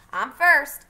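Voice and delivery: loud, high "I'm first"; childish loud claim; eager claim. Acted. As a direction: shout it like a kid grabbing a turn. Scout claims first turn.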